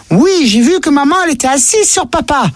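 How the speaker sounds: put-on innocent child telling what he saw; voix d'enfant. Petit garçon parle à sa mère. (voix d'enfant naïve, plus lente)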